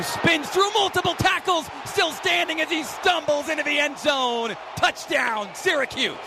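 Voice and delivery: high-pitched, surging play-by-play; thrilled sportscaster calling the score; excited touchdown call. Real, not acted. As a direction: burst upward as the runner breaks free.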